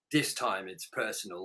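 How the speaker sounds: low, soft trailer-voice line; a hint of a dramatic movie trailer. Mock tagline read while joking about the poster. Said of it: "instead of it being like".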